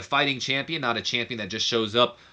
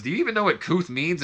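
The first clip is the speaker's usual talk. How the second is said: mock challenge; 8 semitones higher than usual. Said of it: "Coach even goes".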